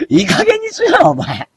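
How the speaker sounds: high falsetto scold, hammed up; mock scolding. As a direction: scold in a shrill put-on voice. Scolding himself as お前. (裏声でふざけて叱る)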